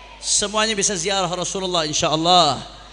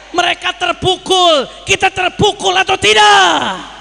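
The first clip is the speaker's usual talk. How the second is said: raised, loud sermon voice building to shouts; rising pulpit fervour, near shouting; fiery preaching; 8 semitones higher, 7 dB louder than usual. Acted. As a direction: rouse the crowd like a preacher at a rally. Preacher rousing the crowd on Palestine.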